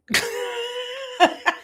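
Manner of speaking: bright embarrassed laugh up high; a high giggle at her own slip; high burst of laughter. Real, not acted.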